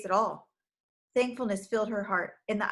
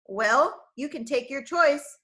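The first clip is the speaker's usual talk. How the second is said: taunting, scornful, a touch raised; sneering; 4 semitones higher, 5 dB louder, slower than usual. Acted. Villain taunting the frightened girl, mild sneer. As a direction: sneer out a mocking offer. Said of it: "sneered Craven Fear".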